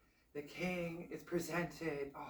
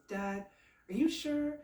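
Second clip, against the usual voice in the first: incredulous son; 7 semitones higher than usual. Acted. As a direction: voice jumping up on are you sure. Son doubting father's order.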